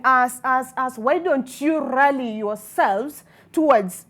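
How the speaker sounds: normal talking voice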